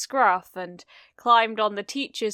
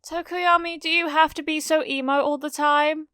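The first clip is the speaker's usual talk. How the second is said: put-on high teasing girl; 8 semitones higher than usual. Mina teasing.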